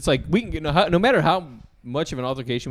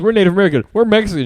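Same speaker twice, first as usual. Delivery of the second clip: loud, high mock announcement; mock declaration; 7 semitones higher, 7 dB louder, faster than usual. Co-host riffs the family's claims, mock-proud and loud. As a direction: call out each one louder, hammed up.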